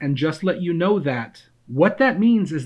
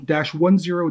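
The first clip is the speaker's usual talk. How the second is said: ordinary delivery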